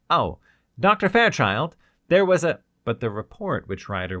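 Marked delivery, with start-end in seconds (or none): 0.1-2.6 louder, higher rush of alarm; blurting. Acted. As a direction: burst out with the news. Blurting news of the deaths.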